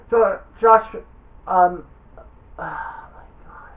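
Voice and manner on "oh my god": breathy whispered oh my God under the breath; slow, soft whisper to yourself. Real, not acted. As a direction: hush it as if embarrassed at blanking. Blanking on a friend's team.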